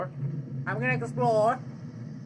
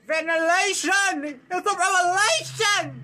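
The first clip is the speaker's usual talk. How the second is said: higher, stretched-out exclamations; mock-dramatic proclaiming; 5 semitones higher than usual. Gamer announcing a revelation to the game, mock-dramatic. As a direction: proclaim it like a grand discovery.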